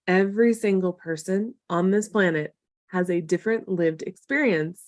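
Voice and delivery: emphatic repeat. Real, not acted. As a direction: let each phrase land separately.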